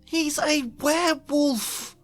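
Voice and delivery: high, urgent warning; frantic warning. A narrator's reading. Hermione frantically warning Harry.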